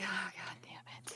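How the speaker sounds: hushed, sighed-out curse; resigned sigh. Acted. Melissa reacting to the mess, a soft resigned sigh. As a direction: sigh it out under your breath. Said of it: "Melissa just sighs".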